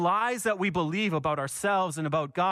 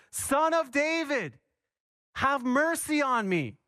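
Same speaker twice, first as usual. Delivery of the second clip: more insistent cry; 7 semitones higher than usual. Retold or imitated. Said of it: "he cried out even more loudly".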